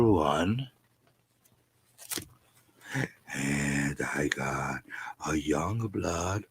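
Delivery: slow, low, whispered droning; mock dull hauler. Mocking boring comic haulers, slow whispered drone. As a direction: drone the list half asleep. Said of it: "they're gonna go".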